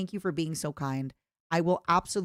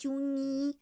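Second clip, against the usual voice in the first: whiny plea; 7 semitones higher, 4 dB quieter, slower than usual. Pretend. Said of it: "Imagine I was like".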